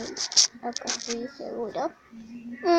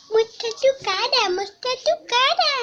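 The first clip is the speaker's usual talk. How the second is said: coaxing pet voice; 10 semitones higher, 9 dB louder than usual. Real, not acted.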